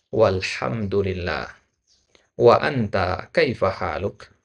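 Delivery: slow, careful reading for students to repeat; slow model reading. Shown as a demonstration. Teacher reads aloud twice so the class can repeat. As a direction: stretch each syllable of the greeting.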